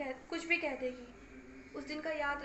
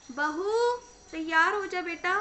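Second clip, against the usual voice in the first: calling out loud; 8 dB louder than usual. Acted. Mother calls daughter-in-law to shop.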